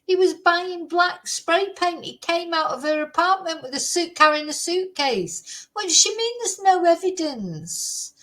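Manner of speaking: venting fury at the news; angry rant. Real, not acted. Vlogger ranting at the lawyer, angry. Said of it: "it just gets me so angry".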